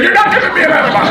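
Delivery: high, fast, loud laughing protest; retold outburst. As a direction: shout it down in panicked refusal. Refusing the enema, retold.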